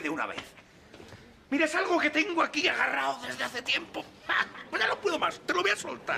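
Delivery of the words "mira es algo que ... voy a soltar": mucho más agudo, fingido y teatral; confesión burlona. Acted. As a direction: finge una confesión dramática riendo. Burla exagerada al amigo. (confesión fingida, muy aguda)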